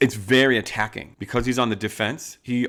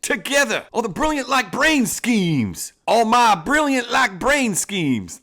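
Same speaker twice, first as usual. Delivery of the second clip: milk every fancy word like a proud outlaw; high, hammed-up outlaw boss; 12 semitones higher, 7 dB louder, slower than usual. Boss reminding his gang of old rides, in a high hammed-up outlaw voice.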